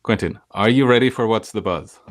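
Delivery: usual speaking voice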